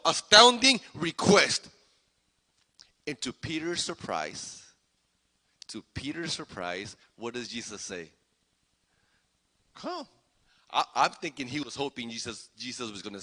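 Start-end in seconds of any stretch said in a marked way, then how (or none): 3.1-8.1 very soft and low, holding back; hushed suspense. Real, not acted. Preacher sets up Jesus's surprising answer, hushed. 9.8-10.1 tiny, high, very soft one-word answer; soft call. Acted. Jesus answers Peter's request to walk on water, soft.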